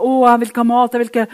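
lightly voiced whining patient; whiny patient. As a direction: moan like a grumpy sick man. Sick man whining in story.

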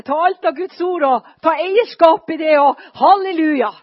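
rising to a loud triumphant halleluja; building from bright to loud jubilant call; God's rousing voice. Retold or imitated. God voiced, urging her to preach.

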